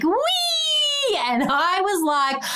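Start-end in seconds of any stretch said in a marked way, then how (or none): 0.0-1.2 a high squeal of joy, like riding no-handed; a squealed, carefree wee; gleeful wee. Retold or imitated. Doctor acting a cyclist letting go, with a high gleeful squeal. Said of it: "you're like".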